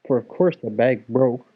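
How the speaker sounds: ordinary delivery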